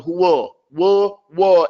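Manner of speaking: "wa" said as a rounded "wo"; wrong, rounded fatha. Shown as a demonstration. Teacher exaggerating the error, loud rounded "wo". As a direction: round the lips on "huwa" into "huwo". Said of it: "You don't want to say".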